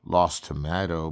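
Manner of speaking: ordinary delivery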